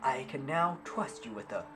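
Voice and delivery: high, loud proclamation; lofty captain voice; grand captain. Acted. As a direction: address the hero with pomp. Captain NPC gives quest.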